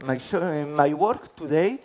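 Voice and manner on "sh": ordinary delivery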